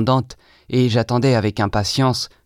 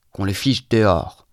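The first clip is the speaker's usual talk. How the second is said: usual speaking voice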